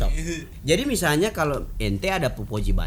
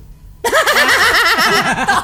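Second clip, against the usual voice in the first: loud high laugh; 6 dB louder than usual. Real, not acted. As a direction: burst of shrill laughter. Mocking a rival's fear. (tawa keras melengking)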